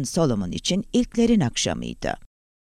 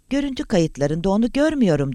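slightly raised, uneasy voice; touch of worry; 6 semitones higher than usual. A narrator's reading. Guard reports on the recordings, a touch worried. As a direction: answer with mild concern. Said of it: "sesinde endişeli bir hava vardı".

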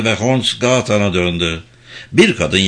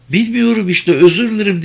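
high, exasperated cry, moderately raised; upset high-pitched outburst; shouted in distress; 9 semitones higher than usual. A narrator's reading. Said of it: "diye bağırdı Vicky".

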